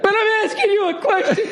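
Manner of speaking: sudden leap to a high cry; hammed-up owner on the edge of tears; mock emotional outburst. Owner insists Gordon answer him, high mock outburst. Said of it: "And he fucking starts crying".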